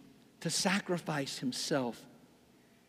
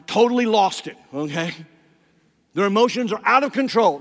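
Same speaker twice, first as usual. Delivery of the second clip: loud, raised-pitch mock outburst; worked-up emphasis; 4 semitones higher, 12 dB louder, faster than usual. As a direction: hammer it out as if losing control. Depicts wrath as losing control.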